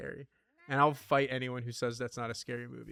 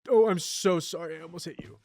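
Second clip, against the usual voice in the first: startled apology; 4 semitones higher, 6 dB louder than usual. Real, not acted. Nearly hits a villager.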